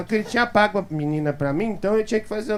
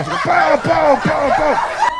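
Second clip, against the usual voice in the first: loud, higher shouted bang noises; mock gunshots; 7 semitones higher, 5 dB louder, much slower than usual. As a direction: bark out gunshot sounds through laughter. Imitating gunshots in the film.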